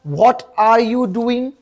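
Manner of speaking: very slow, spaced-out model sentence; slow teaching model. Shown as a demonstration. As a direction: say each word slowly for learners. Teacher repeats the model question. (शिकवण्यासाठी अगदी सावकाश)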